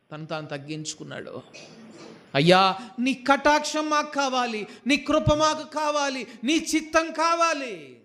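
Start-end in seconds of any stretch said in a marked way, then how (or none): 2.3-8.0 pleading prayer. Acted. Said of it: "యేసయ్య చేసిన ప్రార్థన అక్కడ మోషే చేశాడు".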